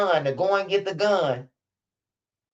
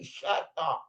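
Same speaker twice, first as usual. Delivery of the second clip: mouthed words; 9 dB quieter than usual. Retold or imitated. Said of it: "She just moved her mouth".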